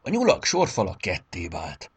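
ordinary delivery, nothing marked

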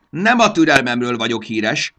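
high, very loud gnome outburst; impatient shout. A narrator's reading. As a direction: shout down the intruders. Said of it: "kiáltotta". (türelmetlen, hangos manókiáltás)

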